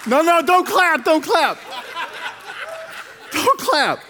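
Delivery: high, laughing mock warning; laughing protest. Real, not acted. Pastor stopping the crowd from clapping, laughing. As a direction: wave off the applause, chuckling. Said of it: "audience clapping at joke".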